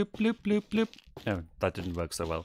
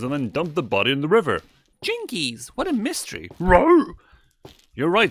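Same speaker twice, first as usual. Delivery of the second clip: higher, bouncy cartoon kid voices; mock Scooby-Doo gang; 7 semitones higher, 5 dB louder than usual. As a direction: play it like a cheery cartoon sleuth. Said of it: "I love doing voices".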